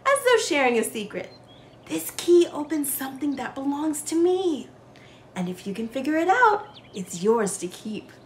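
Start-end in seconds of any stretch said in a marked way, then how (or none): 1.8-8.1 hushed. A narrator's reading. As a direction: lean in and share a secret. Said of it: "his voice hushed".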